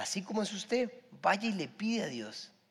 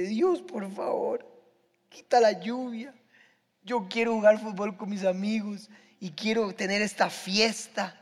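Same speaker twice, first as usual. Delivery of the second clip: high-pitched mock-tearful boy praying; imitated crying child; 7 semitones higher, 5 dB louder than usual. Seven-year-old begging God to stop the rain, imitated tearful plea. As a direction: plead like an upset little kid, drawn out at first. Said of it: "Yo lloré como si me hubi".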